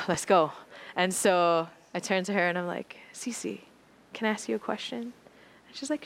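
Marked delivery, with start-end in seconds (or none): 3.1-5.0 hushed ask. Retold or imitated. Nervously asking seatmate quietly.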